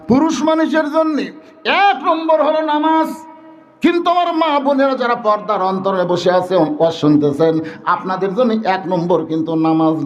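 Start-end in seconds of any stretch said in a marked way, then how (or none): 0.1-6.5 raised, chanting sermon voice; high-pitched preaching. Real, not acted. Preacher tells men that prayer comes first, high preaching. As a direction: proclaim it high to the congregation.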